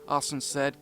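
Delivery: ordinary delivery, nothing marked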